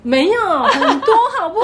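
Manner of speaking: high, loud, slow laughing denial; laughing protest. Real, not acted. Laughing as she insists there were many. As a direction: laugh it out, pushing back.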